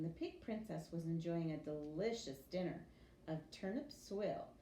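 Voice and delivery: usual speaking voice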